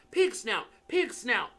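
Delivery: panting. Acted. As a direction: gasp the password out after running. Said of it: "panted Harry".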